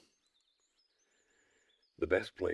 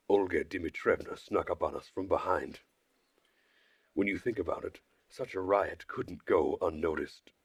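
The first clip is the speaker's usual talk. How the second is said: ordinary delivery, nothing marked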